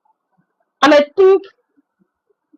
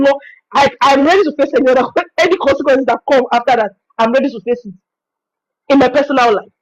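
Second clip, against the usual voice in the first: ordinary delivery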